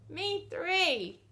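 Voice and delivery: agreeing Green. Acted. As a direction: pipe up brightly. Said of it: "Green agreed and said".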